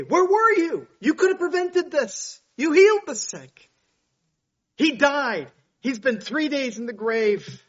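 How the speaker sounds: high, loud, pleading reproach; anguished cry. Acted. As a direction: cry out at him in grief and blame. Lazarus' sister confronting Jesus.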